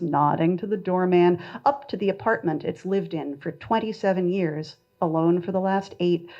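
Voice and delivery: usual speaking voice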